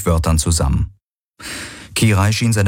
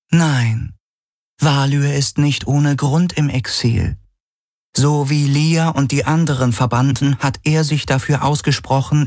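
higher, cool and reserved; reluctant AI voice; 8 semitones higher than usual. A narrator's reading. Helix describes Valyr to Galen, higher and reluctant. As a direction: speak with slight reluctance, voice raised.

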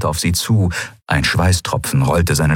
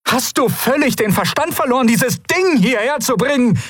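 much higher, furious outburst; furious cry. A narrator's reading. Ruler shouts at her aide for the cube, furious. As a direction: hurl it at him in rage. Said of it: "rief sie".

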